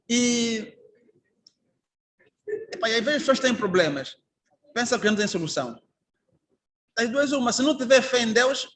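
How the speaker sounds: usual speaking voice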